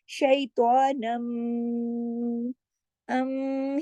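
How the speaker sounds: शैतानम slowed, the final अम hummed through the nose; long nasal ghunna. Shown as a demonstration. Teacher shows the full nasal hum to students. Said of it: "ये जो अम की आवाज है यही गुन्ना है".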